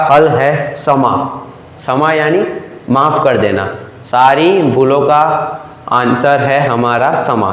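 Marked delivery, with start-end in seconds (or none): 4.1-7.5 slow key line. Shown as a demonstration. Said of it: "teacher stresses key line".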